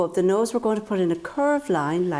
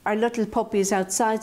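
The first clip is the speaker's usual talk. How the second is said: ordinary talk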